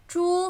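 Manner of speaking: 珠 held high and level; flat tone. Shown as a demonstration.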